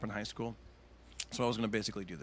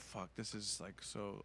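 much softer, low and crushed; dejected mutter; 3 semitones lower, 14 dB quieter, much slower than usual. Retold or imitated. Comic walks off stage crushed, quiet mutter. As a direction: mutter in defeat.

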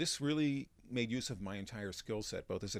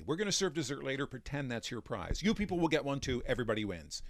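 fast, loud host patter; frenetic showman pace; 4 semitones higher, 5 dB louder than usual. Retold or imitated. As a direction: rush it like a showman working a table. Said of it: "there's a frenetic pacing to what I'm doing".

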